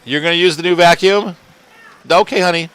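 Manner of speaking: sweet coaxing to family. Real, not acted. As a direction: gently warn honey about the cheap vacuum.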